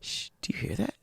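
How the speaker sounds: imitating someone hushing to listen; soft, whispery quote of a puzzled caller; hushed questioning. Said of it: "you were like".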